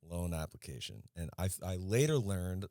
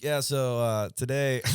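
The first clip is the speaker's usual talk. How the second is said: high, loud mock news anchor; newscaster voice; 6 semitones higher, 8 dB louder, slower than usual. Joking to cohost about anchors on air, high loud mock news voice. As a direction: ham up a casual newsreader opening. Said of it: "somebody just got on the news and they're like".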